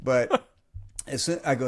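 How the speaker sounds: ordinary talk, nothing marked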